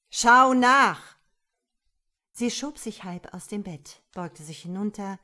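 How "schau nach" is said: very loud, higher bellow; shouted command. A narrator's reading. Man ordering woman to check under bed, shouting.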